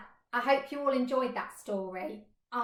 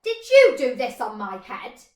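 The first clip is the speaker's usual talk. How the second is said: much louder accusing question; indignant shout; 13 dB louder than usual. Acted. Mole shouting up at the pig.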